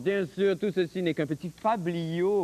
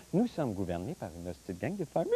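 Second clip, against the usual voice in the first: lower, softer cooing; cutesy soft voice; 13 semitones lower, 8 dB quieter, faster than usual. Shown as a demonstration. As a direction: say it sweetly to a kitten. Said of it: "Avec le chaton". (voix douce et gaga)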